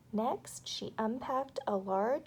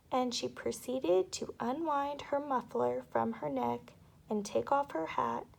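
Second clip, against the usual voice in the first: ordinary delivery, nothing marked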